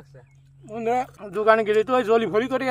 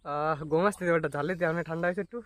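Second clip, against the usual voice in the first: normal talking voice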